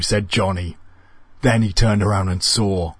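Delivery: ordinary delivery, nothing marked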